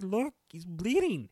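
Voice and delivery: high, loud and slow imitation; retold insistent protest. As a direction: drag it out high, driving the point home. Retold argument over snake bite.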